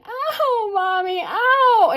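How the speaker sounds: put-on high, wailing child's cry, dragged out slowly; imitated child crying. Little girl crying out to her mommy in the store. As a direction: cry out like a small hurt kid. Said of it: "the child crying and going".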